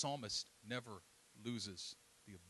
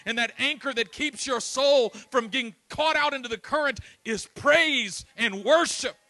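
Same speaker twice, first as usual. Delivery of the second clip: loud, high, ringing preacher's cry; loud fervent sermon delivery; full-force preaching; 13 semitones higher, 16 dB louder than usual. Acted. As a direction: preach it out to the back row.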